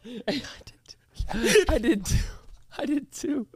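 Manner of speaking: high, hushed, giggling admission; whispered laughing confession. Real, not acted.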